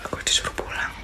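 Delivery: barely audible, voiceless whisper; hushed whisper. Real, not acted. Ghost hunter told to go home, hushed whisper. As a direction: whisper it with long pauses.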